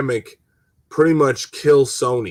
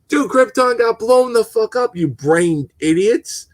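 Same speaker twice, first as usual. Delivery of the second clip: angry outburst; 8 semitones higher than usual. Real, not acted. Ranting at the brain-dead writers, angry yell. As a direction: blow up at them in disbelief.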